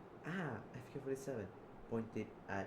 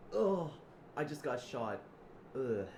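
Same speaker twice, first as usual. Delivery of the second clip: loud groans around the words; hammed-up wounded outcry; acted gunshot pain; 3 semitones higher, 7 dB louder, slower than usual. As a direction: grunt as if just hit by a bullet. Acting out being shot.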